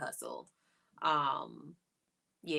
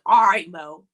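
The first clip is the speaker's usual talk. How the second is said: mock exasperated husband; 2 semitones higher, 12 dB louder than usual. Imitating her husband answering her, loudly exasperated. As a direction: call it out like a husband backing off.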